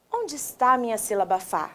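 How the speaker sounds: normal talking voice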